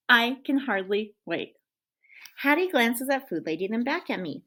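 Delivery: put-on lively read-aloud for children; story narration. A narrator's reading. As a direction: tell it like a playful dog to a class. Teacher reads dog's story aloud.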